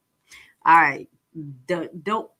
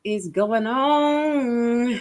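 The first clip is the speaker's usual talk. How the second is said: slow, singsong astonished "what is going on"; drawn-out disbelief; much slower than usual. Acted. Host reacting to stuff that blows their mind, drawn-out disbelief. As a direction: stretch the words out long and slow. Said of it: "I be like".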